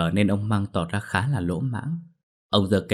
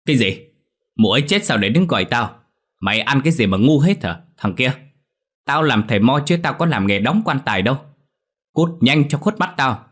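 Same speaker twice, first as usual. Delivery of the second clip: loud, higher-pitched indignant scolding; irate old shaman, voice raised; angry; 5 semitones higher, 5 dB louder than usual. A narrator's reading. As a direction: tell him off and send him packing. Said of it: "Giọng lão thầy Mo chuyển sang tức giận".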